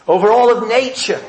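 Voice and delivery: ordinary talk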